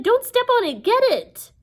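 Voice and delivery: very high, loud orders; frantic yell. Acted. Annabeth to Percy about the eyeball, frantic yelling. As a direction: shout frantic urgent commands.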